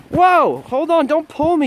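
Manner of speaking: sudden high cry as the dog pulls; startled yelp. Real, not acted. Owner yanked by his dog on the leash, a startled high yelp.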